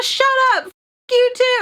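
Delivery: very high-pitched mock yell; mad outburst. Drunk, snapping at Mojo, mad high-pitched retort. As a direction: shriek it in irritation, without much extra volume. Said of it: "all mad at him".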